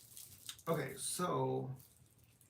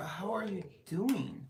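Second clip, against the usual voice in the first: slow, higher, put-on British accent; mock British accent; 6 semitones higher, 3 dB louder, slower than usual. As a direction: greet a viewer as if British, slowly. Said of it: "My British accent".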